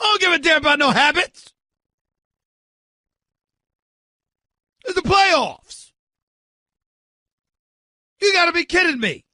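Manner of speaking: loud, high, furious yell; outraged shout. Real, not acted. Host blasts the manager's excuses, furious shout. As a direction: blast it with disbelief.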